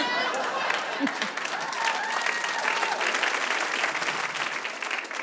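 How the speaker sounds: high squeal. Retold or imitated. Wordless high falsetto sound.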